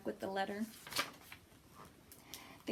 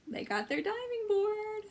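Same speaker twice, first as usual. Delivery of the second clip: much higher delighted voice; excited reveal; 11 semitones higher than usual. Acted. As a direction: announce it like a thrilling surprise.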